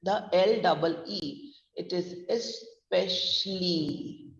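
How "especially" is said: especially drawn out slowly, split into es-pesh-lee; syllable-by-syllable pronunciation. Shown as a demonstration. Teacher showing how the word is said, slow syllable-by-syllable pronunciation. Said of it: "we are going on a higher note".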